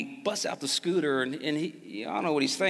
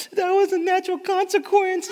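put-on high, louder, prim child voice; wise little kid; 7 dB louder than usual. Son solemnly lecturing dad, high prim voice. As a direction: state it like a serious four-year-old. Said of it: "And he's like".

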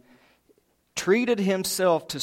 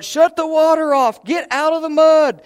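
put-on much higher and louder yell at the kids; father yelling at kids in the muddy yard, hollering; 13 semitones higher, 8 dB louder than usual. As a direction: shout across the yard. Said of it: "starts hollering".

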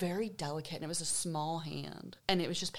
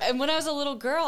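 laughing; 8 semitones higher, 8 dB louder, faster than usual. Real, not acted. Laughs before the story.

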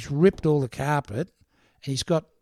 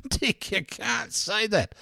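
put-on higher, slow, scolding girl's voice; shocked ad girl; 7 semitones higher, slower than usual. Newspaper girl telling Dick off, slow and higher. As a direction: draw it out like a shocked receptionist. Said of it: "The girl that's gonna go".

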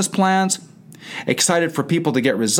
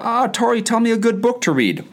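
mock client; 9 semitones higher than usual. Mimicking a client's request.

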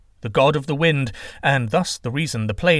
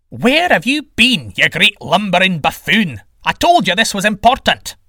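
high, loud, indignant rant; exasperated god telling someone off; angry scolding sky god; 8 semitones higher, 7 dB louder than usual. Acted. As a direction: berate a latecomer at full volume. Sky god berates late wind god.

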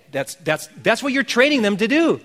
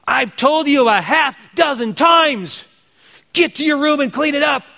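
mocking a nagging parent's shout; exasperated parent yell; 8 semitones higher, 4 dB louder than usual. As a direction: bark it like a parent at the end of their rope. Parent yelling at kids.

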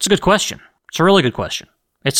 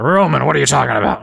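mock listener. Imagined listener questioning him.

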